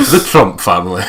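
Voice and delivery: chuckling through the line; laughing. Real, not acted. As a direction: laugh as you say it. Laughing joke.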